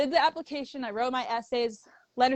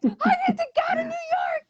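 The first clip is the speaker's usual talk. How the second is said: tearful excitement. Retold or imitated. Telling mom the big news.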